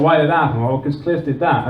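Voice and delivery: ordinary delivery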